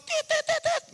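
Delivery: string of high imitated bleeps; censor beeps. Preacher bleeping out swearing about the rain, high beeps.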